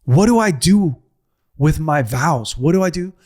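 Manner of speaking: retelling a raw, pleading outburst to God; anguished cry to God. Retold or imitated. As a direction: cry out to God, begging for an answer. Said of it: "I literally say this".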